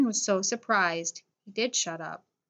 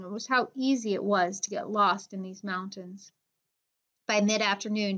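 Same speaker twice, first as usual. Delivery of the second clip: ordinary delivery, nothing marked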